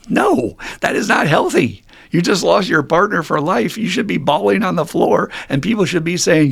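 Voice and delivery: exasperated, impassioned objection; emphatic protest. Real, not acted. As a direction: push back hard, loud and high. Emphatic protest at unhealthy advice.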